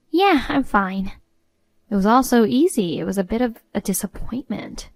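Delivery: ordinary delivery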